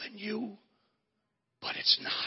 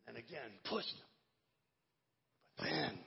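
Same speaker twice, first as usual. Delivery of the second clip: usual speaking voice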